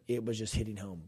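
normal talking voice

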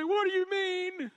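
put-on a wounded child asking, high and drawn out; imitating daughter. Father acting out his daughter's hurt question, high child voice. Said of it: "She'll say".

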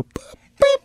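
very high falsetto chirp; squeaky top-of-range beep; high beep boop. Shown as a demonstration. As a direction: show off the high note on the beep. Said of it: "interested that you can't go".

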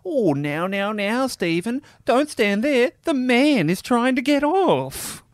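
high, slow, patronising "now, now"; condescending parent voice. Retold or imitated. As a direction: talk down sweetly to a child. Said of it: "he goes".

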